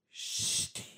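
voiceless, drawn-out hissed Steve; imitating the recording. Friend mimicking what the ghost audio sounds like, imitated hiss. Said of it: "It sounds like a".

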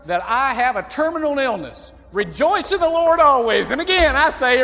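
hammed-up forced joy, ending in a loud proclamation; grinning lady. Preacher imitates a lady rejoicing over her illness, hammed-up loud. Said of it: "She'd come in and say".

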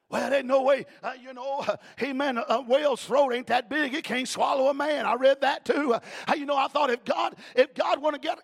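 loud, high hollering sermon with a gasped "uh" after each phrase; full-force preaching. Acted. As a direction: preach it out loud to the whole church.

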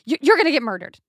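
hammed-up alarmed sister on the phone; mock panic, words tumbling out fast; freaking out. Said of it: "She's freaking out".

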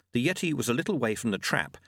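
ordinary delivery, nothing marked

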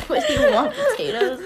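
squeaky giggling question; high-pitched laughing voice. Real, not acted.

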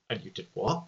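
low, soft, quietly menacing question; subtle menace. Retold or imitated. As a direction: imitate a quiet, understated threat. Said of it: "subtle kind of, like, menace".

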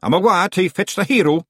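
high, loud Scottish voice; Feegle chief. A narrator's reading. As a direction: proclaim it as a wee Scots chieftain. Chief announces his quest to clan.

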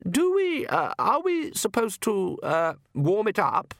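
very high, hesitant countess; nervous. Acted. Wife unsure how wine is served, nervous. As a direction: feel your way slowly through the question. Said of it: "His wife smiled nervously".

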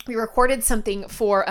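normal talking voice

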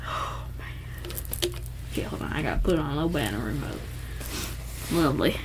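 very soft, fast muttering; whispered aside. Real, not acted. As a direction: mumble to yourself. Near-silent muttering aside.

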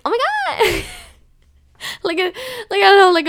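put-on high, squealed valley girl gasp; a sudden valley girl oh my God. Shown as a demonstration. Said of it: "like, a Valley Girl voice".

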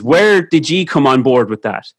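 ordinary delivery

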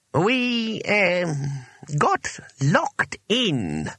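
high, halting admission; slow, reluctant wizard voice. A narrator's reading. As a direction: drag out an embarrassing confession.

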